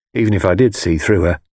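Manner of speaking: ordinary delivery, nothing marked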